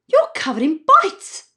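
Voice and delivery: worried scolding. Acted. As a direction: scold like a mum. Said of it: "my mum scolds".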